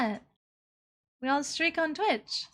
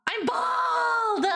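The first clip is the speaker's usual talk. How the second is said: mock outrage; 6 semitones higher, slower than usual.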